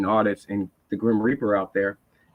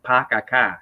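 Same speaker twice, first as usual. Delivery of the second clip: "pa ka ka" in a Boston accent with the R's dropped; 3 dB louder, slower than usual. Shown as a demonstration. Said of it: "like the famous Boston accent".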